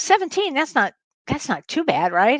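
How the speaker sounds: a high, breezy mock of her sister. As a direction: toss off the cold like it's nothing, in a put-on high voice. Imitating sister downplaying the cold.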